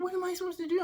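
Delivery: high, frantic question. Retold or imitated. Boss asking the employee for help, frantic. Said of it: "lower your voice".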